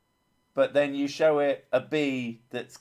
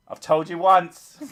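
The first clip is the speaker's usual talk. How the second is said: raised, loud mock telling-off; mock scolding; 6 semitones higher, 10 dB louder than usual. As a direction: scold like a fed-up parent, in jest. Acted parent scolding a kid.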